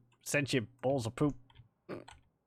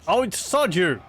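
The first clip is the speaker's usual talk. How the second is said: loud, higher, annoyed outburst; exasperated cursing; 6 semitones higher, 6 dB louder, slower than usual. Real, not acted. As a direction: curse the bad luck out loud. Exasperated cursing at game setback.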